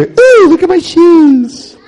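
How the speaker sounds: high, loud, drawn-out coo; hammed-up fascinated "ooh", stretching the words; mock distracted delight. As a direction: get sidetracked with childlike wonder. Pretends to be distracted by shoes.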